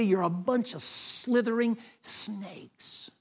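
slow, hushed near-whisper; soft, stretched-out condemnation; menacing rebuke. Acted. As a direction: accuse them under your breath, drawing out each word. Voicing John's rebuke of Pharisees.

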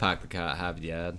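Boston accent. Shown as a demonstration. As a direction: drop the Rs the Boston way. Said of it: "Do it".

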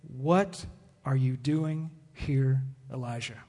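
low, soft and very slow, spaced words; hushed divine voice, drawn out; voice of God. Acted. Voice of God questioning Elijah at the cave, hushed. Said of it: "suddenly a voice came to him".

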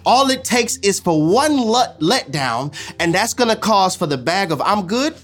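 building, rising sermon cadence over music; impassioned pulpit preaching. Acted. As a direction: preach it out, lifting toward the climax. Preacher at full force with music.

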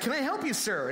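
high-pitched eager start, dropping on the last word; chipper young worker. Retold or imitated. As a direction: imitate an eager kid serving a customer. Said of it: "he's all chipper".

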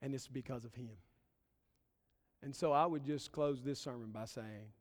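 ordinary talk

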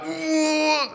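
long, high-pitched cringing "ooh"; mortified ooh. Retold or imitated. New worker realising his blunder to the dentist, mortified. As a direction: squeal a drawn-out mock wince.

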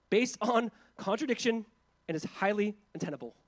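a preacher reading a text with conviction; forceful reading aloud. Acted. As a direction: read it out loud and firm, driving each point home. Reads a passage aloud forcefully.

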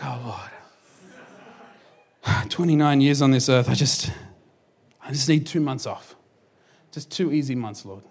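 low, soft, tired sigh of a plea; weary prayer. Retold or imitated. Pastor begging God for rest, low and weary. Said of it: "I was like".

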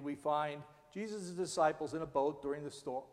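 normal talking voice

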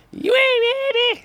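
very high, loud call from the back; distant heckler. Retold or imitated. Heckler calling from the back of the theater, high yell. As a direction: holler it from the back row. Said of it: "somebody all the way in the back go".

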